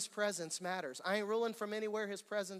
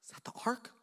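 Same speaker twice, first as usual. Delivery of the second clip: whispered question; 5 semitones higher, faster than usual. Acted. Acted nervous question at door.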